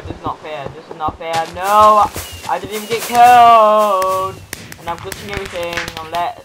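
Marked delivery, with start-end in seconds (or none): 1.5-4.4 loud, drawn-out complaint; real aggrieved gamer shout; indignant protest. Real, not acted. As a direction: wail it out in protest. Protests an unfair game result.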